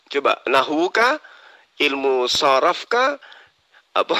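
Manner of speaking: ordinary delivery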